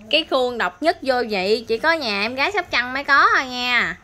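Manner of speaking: loud, high-pitched bragging; playful boasting. Real, not acted. Vlogger telling viewers the mould is one of a kind, boastful. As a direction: proclaim it proudly and loudly, as a joke.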